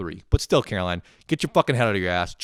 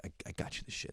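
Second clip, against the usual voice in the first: whispered aside; 17 dB quieter, much faster than usual. Retold or imitated. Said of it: "you know, like".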